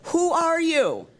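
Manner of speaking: high, loud, drawn-out mock demand; imitating mean director. Director to the new trainee over loudspeaker, mock outrage. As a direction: mimic an exasperated director challenging you. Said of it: "as raw and mean as they come".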